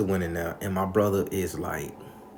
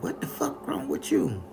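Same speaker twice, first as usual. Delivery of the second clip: high whispered grandma voice; grandma demanding; 10 semitones higher than usual. Retold or imitated. Grandma confronting the sad girl, high and hushed. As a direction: hiss it like a fed-up old lady. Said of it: "And grandma like".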